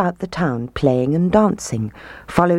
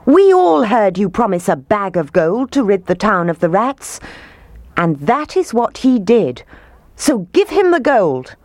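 high, insistent demand; angry shout; 5 semitones higher than usual. A narrator's reading. Townspeople holding mayor to his promise, angry shout. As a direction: press the demand, rising higher. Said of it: "they shouted".

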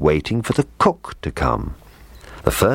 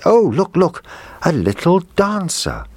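happy cry; 13 semitones higher than usual. A narrator's reading. Little girl spotting the dancer, happy cry. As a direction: call out in delight. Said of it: "cried out happily".

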